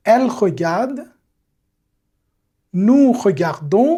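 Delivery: each form set apart by long pauses; teacher pacing a repeat-after-me drill; slow drill for repeating. Shown as a demonstration. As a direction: model every form slowly so the class can echo it. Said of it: "Répétez avec moi".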